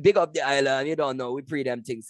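loud mock Jamaican accent; Chet Hanks. Comedian imitating an actor's son showing off patois, exaggerated Jamaican accent. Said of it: "when Chet Hanks is like".

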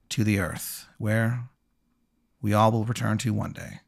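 slow, hushed graveside narration with long pauses; measured, mournful storytelling; solemn scene setting. Acted. Game master narrates the funeral solemnly.

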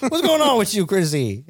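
high, laughing, teasing mobster voice; mocking Tony. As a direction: tease him in a squeaky mock voice. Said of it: "Tony calls him up and he's like".

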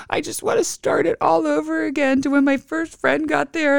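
high child voice, a hint of tears; lightly voiced crying child. Mother imitating her son crying in the bath. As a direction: mimic a sad little boy, pitched high. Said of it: "he's like".

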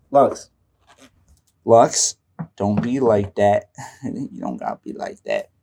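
quiet, low coaxing to a pet; soft coaxing. Real, not acted. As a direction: gently talk down to the dog. Talking softly to the pet Lux.

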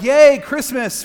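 loud, high, drawn-out yay; excited cheer. Acted. Imitating people thrilled about Christmas, excited cheer. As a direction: cheer it with full festive glee.

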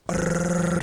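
high rolled trill; rapid buzzing mouth noise; imitated racing heartbeat. Imitating the dog's heart beating out of his chest, trill. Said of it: "just beating out of his fucking chest".

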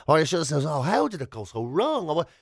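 ordinary talk

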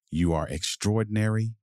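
ordinary delivery, nothing marked

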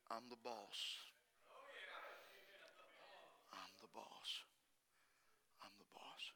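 very soft, low repeated declaration; low and quiet, let each word settle; hushed. Acted. Voicing God, very quiet repeated.

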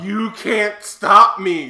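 slow loud villain boast; megalomaniac rant. Retold or imitated. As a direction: gloat it like a movie villain.

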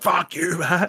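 high-pitched, playful jeer; mock taunt. Imagined banner taunting a rival player, mock taunt. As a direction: throw a cheeky insult at a rival.

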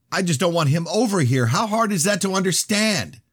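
high, loud exasperated yell. A narrator's reading. Danielle arguing over a visitor in the house, yelling. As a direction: yell it high, at the end of patience. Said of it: "Her yelling only made me louder".

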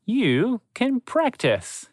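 high, slow, smiling offer; a slow smiling invitation, lifted high; with a smile. A narrator's reading. Mentor smiling at the student, slow and high. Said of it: "he added with a smile".

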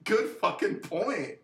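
slow, emphatic mock admiration; mock impressed. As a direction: savour each word, mock impressed. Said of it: "I'd be like".

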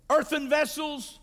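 ordinary talk

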